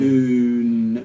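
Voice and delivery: oon stretched slowly so it sounds like two syllables, oo-oon; drawn-out oon. Shown as a demonstration. Tutor showing Mo how moon splits, slow stretched demo. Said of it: "it sort of sounds like two".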